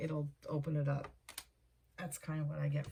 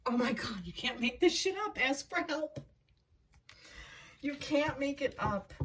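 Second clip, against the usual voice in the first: real excitement; 8 semitones higher than usual. Real, not acted. Reader amazed at repeated cards, excited high exclaims. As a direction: let it burst out, amazed by the cards.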